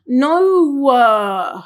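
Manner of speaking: "No" drawn out into a trailing "uh"; added uh ending. Shown as a demonstration. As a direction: stretch "No," then tack on a voiced "uh". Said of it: "this new linguistic thing".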